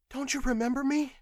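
lightly voiced woman, pitched high and pleading; a hint of a desperate wife begging to be recognised; woman asking her husband, gently pleading.

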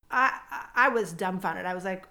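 usual speaking voice

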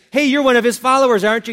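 loud, high-pitched challenge; accusing call. Retold or imitated. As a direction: call him out in front of everyone. Accuser confronting Peter in retold story.

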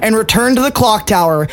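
high, building dramatic narration; epic narration. Acted. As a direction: narrate the final blow with rising excitement. Game master narrates climax.

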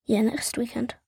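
whispered yeah. Real, not acted. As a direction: say it under breath. Whispered agreement.

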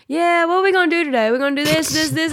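high, quick mock chatter; playful take on a talkative husband; imitating chattering husband. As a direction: rattle it off high and fast, imitating an interrupter. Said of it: "And he's like".

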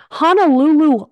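normal talking voice

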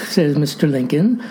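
usual speaking voice

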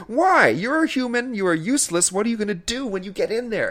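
high-pitched mock-outraged questioning; exasperated rant. Moviegoer berating the woman breaking into the window, exasperated. Ranting at the film's heroine.

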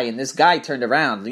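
usual speaking voice

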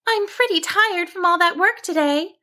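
high-pitched sheep, mildly weary; a touch of tiredness in a high little voice; sighing to herself. A narrator's reading. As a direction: sigh it in a high character voice. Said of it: "she sighed to herself".

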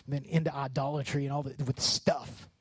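ordinary talk, nothing marked

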